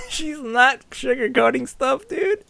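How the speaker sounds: high, slow, laughing reaction. Real, not acted. Amused player reacting to Lind's blunt line, laughing. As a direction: squeeze the words out through laughter.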